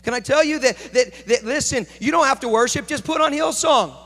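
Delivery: fervent pulpit delivery; preaching at full force. Acted. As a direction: drive it home to the congregation. Preaching to congregation.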